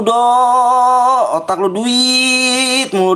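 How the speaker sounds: high, slow nagging mock voice; mocking whiny kid. Streamer mocking a greedy kid in chat, high whiny taunt. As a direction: drag it out like a whiny brat.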